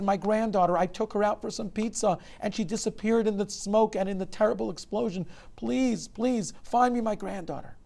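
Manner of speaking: high, loud frantic grandfather; impassioned, high-pitched old man's plea; desperate begging. A narrator's reading. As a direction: beg for help in rising panic. Desperate grandfather begs for help.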